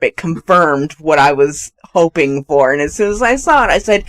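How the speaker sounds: normal talking voice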